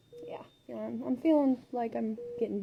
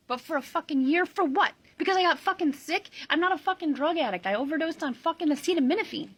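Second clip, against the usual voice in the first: furious; 6 semitones higher, 4 dB louder than usual. Real, not acted. Furious rant about the year.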